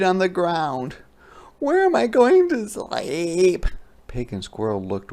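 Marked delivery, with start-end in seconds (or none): 1.6-3.7 high, slow, plaintive hedgehog voice; squeaky worried question. Acted. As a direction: wail it like a lost little animal.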